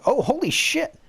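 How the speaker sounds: sudden high shocked exclaim; shocked outburst. Real, not acted. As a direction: blurt it out in real shock. Sudden shock at mother appearing.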